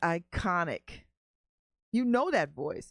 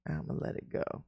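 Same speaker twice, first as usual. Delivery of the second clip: muttered to himself; 7 semitones lower, 6 dB quieter than usual. Real, not acted. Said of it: "repeating to calm himself".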